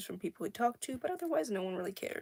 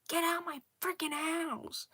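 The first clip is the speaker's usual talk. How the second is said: mock yell; 7 semitones higher, 4 dB louder than usual. Brother yells at him.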